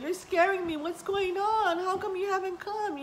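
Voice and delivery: high-pitched retold panic; scared. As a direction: re-enact anxious, pleading questions. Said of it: "getting scared".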